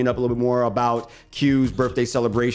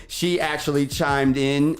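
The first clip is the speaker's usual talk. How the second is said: ordinary talk, nothing marked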